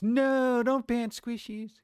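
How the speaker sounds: high, slow pleading whine; mock plea. Streamer begs readers not to ban squishies, high whine. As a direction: beg like a kid losing a toy.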